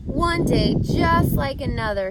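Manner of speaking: loud, high groan of misery. A narrator's reading. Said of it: "he groaned".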